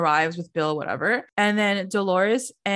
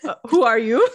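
louder, amused mock challenge; laughing retold put-down; 5 dB louder than usual. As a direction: laugh through the mock indignation. Laughing while retelling Margaret's put-down.

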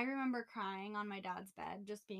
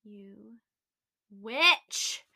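muttered insult, witch loud; 11 dB louder than usual. Retold or imitated. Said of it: "And I was like".

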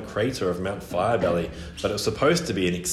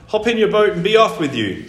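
high, loud albatross order; bossy bird; 9 semitones higher, 7 dB louder than usual. A narrator's reading. Albatross sending boy off the island, bossy. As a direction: shoo him away loudly, pitched up.

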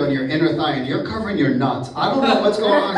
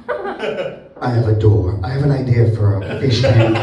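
lower, louder hammed-up fish pitching an idea; mock Nemo pitching; 4 semitones lower, 4 dB louder than usual. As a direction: play Nemo as a pushy investor pitch, loud. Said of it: "and was like".

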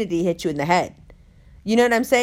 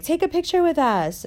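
higher, bright request; chirpy girl; 6 semitones higher than usual. Retold or imitated. Girl asking Umber into the group photo, higher chirpy voice. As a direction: ask for the photo, pitched up. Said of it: "she's like".

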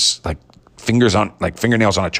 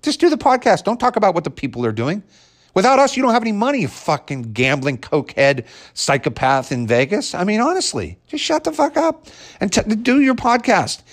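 exasperated rant; 13 semitones higher than usual. Real, not acted. Ranting at the absent host.

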